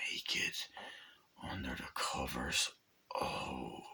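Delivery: whispered, lingering aside; hushed. Retold or imitated. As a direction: drop to a near whisper, savouring it. Lingering on a nude scene.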